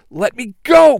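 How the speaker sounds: high, loud protest; struggling yell. A narrator's reading. Pink Ranger pinned by Nega fighters, high loud yell. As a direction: yell to be released. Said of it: "Pink Ranger yells".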